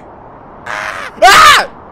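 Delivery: high loud jump-scare yell; sudden frightened shriek; startled scream. Real, not acted. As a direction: scream as if jumped at. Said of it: "He just got startled".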